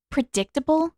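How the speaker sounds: high, questioning "Predictable?"; skeptical. A narrator's reading. Katara to Zuko about Zhao, skeptical question. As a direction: doubt every syllable of it. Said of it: "skepticism in her voice".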